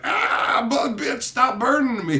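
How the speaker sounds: high pleading whine; a hint of a whining deity; mock whiny god. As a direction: complain like a burning brat. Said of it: "the god's like".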